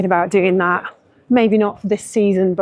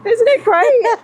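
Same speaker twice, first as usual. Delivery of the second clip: excited; 14 semitones higher, 4 dB louder, slower than usual. Real, not acted. Thrilled greeting her guest.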